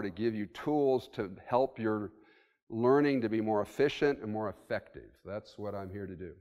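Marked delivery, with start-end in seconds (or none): none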